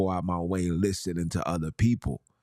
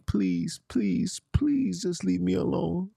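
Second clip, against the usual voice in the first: much higher, pleading in a mock whine; a hammed-up plea to the intruders at his home; mock begging; 10 semitones higher, slower than usual. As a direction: beg like someone cornered at his door.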